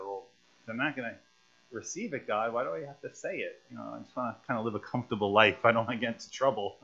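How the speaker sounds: muttered fast under the breath; quiet, quick aside. Retold or imitated. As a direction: rush it softly like a private grumble. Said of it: "I would have said".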